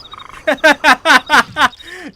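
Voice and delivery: 3 a burst of exasperated laughter; 4 laughing in disbelief at the game. Real, not acted. Bitter laugh mid rant.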